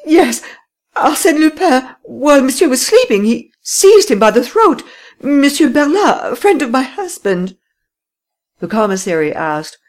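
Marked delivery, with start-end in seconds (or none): 0.0-7.6 a high, loud, lightly tearful woman's voice; lady tearfully telling the police; weeping. A narrator's reading. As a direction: still upset, half-sobbing the story out. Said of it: "Then she continued weeping".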